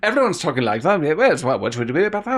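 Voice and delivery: quick mock mid-Atlantic accent. Mocking the actors on set, mid-Atlantic accent. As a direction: imitate old-film mid-Atlantic actors. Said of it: "everyone has this mid-Atlantic accent".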